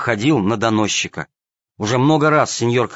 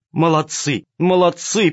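delighted praise; 6 semitones higher, slower than usual. A narrator's reading. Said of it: "повторяла она".

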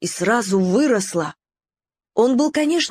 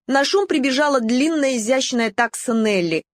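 plain reading voice

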